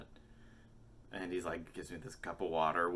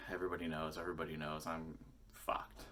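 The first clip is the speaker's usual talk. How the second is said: paranoid mutter; 2 semitones lower, 7 dB quieter than usual. Retold or imitated.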